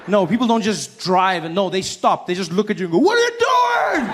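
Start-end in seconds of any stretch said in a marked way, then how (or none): 3.0-4.1 high, loud, slow outraged yell; yelling driver. Retold or imitated. Other drivers yell at the wrong-way driver, high outraged shout. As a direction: bellow at the fool on the wrong side.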